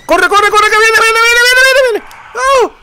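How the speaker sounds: agudo y fuerte, cada vez más alto; gritos de pánico. Real, not acted. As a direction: grita con urgencia mientras huye. Huye del monstruo gritando. (huye del monstruo a gritos, en pánico)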